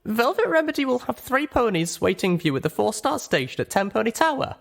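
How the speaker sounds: put-on a young mare thanking her contact. Little Pip's own spoken voice.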